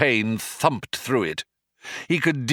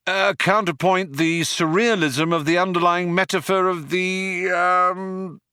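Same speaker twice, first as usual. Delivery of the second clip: higher hesitant bluff; floundering Ford; 10 semitones higher than usual. Acted. Ford tries to rescue Arthur and flounders too. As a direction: stumble to a halt mid-praise. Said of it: "he floundered too".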